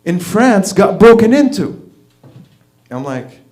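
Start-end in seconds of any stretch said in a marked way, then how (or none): 0.1-1.9 mock yell, higher and louder; retold scream. Wife screams at husband on the couch, mock scream. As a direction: imitate a wife yelling shocking news. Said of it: "she screams at me and she goes".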